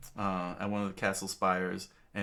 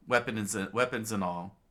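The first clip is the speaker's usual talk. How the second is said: ordinary delivery, nothing marked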